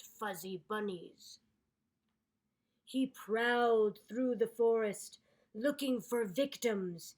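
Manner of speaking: loud, dramatic villain build-up; ominous storybook narration. A narrator's reading. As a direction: narrate like he's a scary predator.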